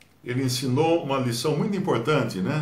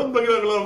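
mock babbling; 9 semitones higher than usual. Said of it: "Um começa lá com blá".

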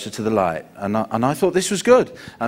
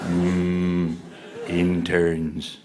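Clinton voice; 6 semitones lower, much slower than usual. Acted. Clinton voice, lower.

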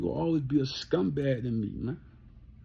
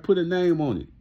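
louder, punchier "Put a name on it", with a hard push on "Put"; challenging callout; 4 semitones higher, 7 dB louder than usual. Retold or imitated. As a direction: call him out and dare him to name names. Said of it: "I like".

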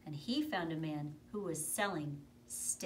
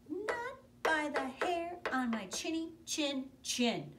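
high, slow, stubborn little pig; little pig refusing; 10 semitones higher, 7 dB louder than usual. Acted. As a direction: squeak it out, standing firm.

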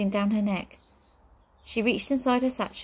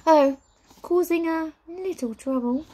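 high, airy witch voice; teasing witch; 9 semitones higher, 5 dB louder than usual. A narrator's reading. Witch needles her brother, teasing and high. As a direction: toy with him, pitched high.

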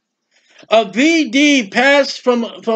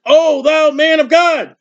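crying out; 6 semitones higher, slower than usual. A narrator's reading. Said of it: "they cried out and said".

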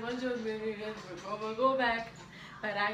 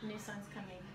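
quiet; 4 semitones lower, 13 dB quieter, faster than usual. Real, not acted. Warning over headphone music.

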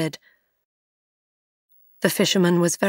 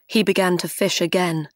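ordinary delivery, nothing marked

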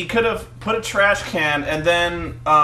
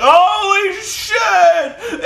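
high, loud, stretched-out wail; mock shocked scream; 6 dB louder, much slower than usual. Host acts out a bloodied wrestler's reaction, shrieking mock horror. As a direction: scream it slowly in hammed-up horror.

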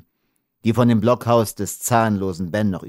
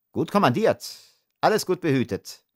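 sneering, pitched up high; mocking; 9 semitones higher than usual. A narrator's reading. Lieutenant mocks the blond rider, sneering high. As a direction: jeer at him with false praise. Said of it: "höhnisch".

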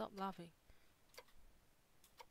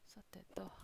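whispering; 10 dB quieter than usual. Real, not acted.

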